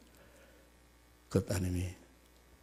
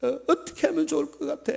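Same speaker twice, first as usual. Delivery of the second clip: higher, urgent plea to her mother; desperate daughter; 14 semitones higher, 6 dB louder than usual. Acted. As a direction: beg mom what to do. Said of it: "막 이러면서 엄마한테 막".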